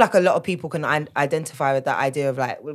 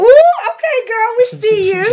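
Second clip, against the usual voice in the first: excited; 11 dB louder than usual. Real, not acted. Hyping up a friend's news.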